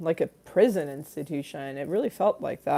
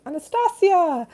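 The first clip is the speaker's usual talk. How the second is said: ghostly call; 12 semitones higher, 4 dB louder than usual. Retold or imitated. Ghost calling her name.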